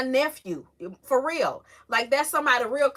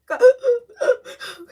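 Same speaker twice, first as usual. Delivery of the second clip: high, loud laugh breaking into a cry; 10 semitones higher, 8 dB louder than usual. Real, not acted. Friend reacting to the story, laugh breaking into tears. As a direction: let a teary laugh burst out.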